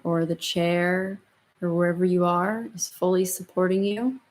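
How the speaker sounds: slow, soft meditation voice; calm and unhurried, gently guiding; guided meditation. Acted. Leads the closing meditation.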